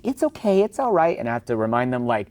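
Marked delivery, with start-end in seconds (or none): 0.0-1.2 high, cooing reassurance to the dog; soothing owner. Retold or imitated. Owner soothing lunging dog, high cooing voice. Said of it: "they're saying".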